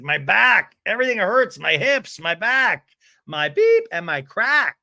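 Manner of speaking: punchy groaning list, loud on each ache; mock aching complaint. Recapper mimics Emily hurting all over, mock aching complaint. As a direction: complain loudly, piece by piece, hammed up.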